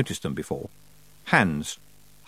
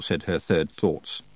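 ordinary delivery

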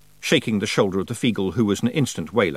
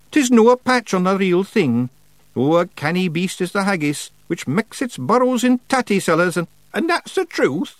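high, loud Scots pictsie; cheeky wee Feegle; 11 semitones higher, 4 dB louder than usual. Acted. Feegle flatters the witch, cheeky. Voices Feegle spinning excuse.

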